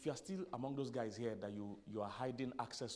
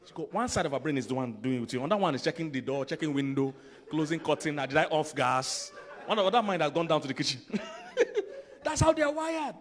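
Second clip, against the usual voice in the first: much louder, rising into laughter; loud laughing story about wives; excited comic build; 3 semitones higher, 13 dB louder than usual. Real, not acted. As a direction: sell the joke bigger and bigger. Comic picture of women's minds.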